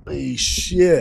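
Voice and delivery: very slow, drawn-out amazement; amazed exclamation. Real, not acted. Guest just arriving on set, drawn-out amazement. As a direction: stretch it out in awe.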